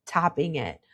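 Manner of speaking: usual speaking voice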